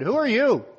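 high, loud startled question; startled girl. Acted.

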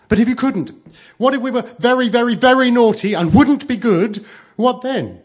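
put-on very high, loud childish challenge; insistent child. A narrator's reading. Child testing mother about being naughty, insistent. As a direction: push mother with a shrieky little voice.

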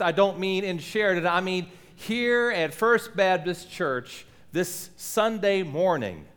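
ordinary talk, nothing marked

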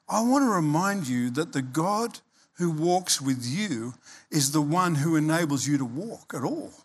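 usual speaking voice

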